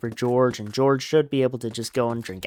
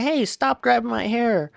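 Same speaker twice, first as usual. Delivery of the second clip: high-pitched mock protest; put-on woman's voice, much higher, objecting; Curly's wife; 11 semitones higher than usual. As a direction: squeal it up high in mock alarm. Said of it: "she's like".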